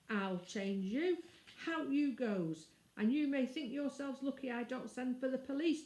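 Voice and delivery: put-on surly tradesman driving children out; gruff threat. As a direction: lean hard on each word to scare the kids.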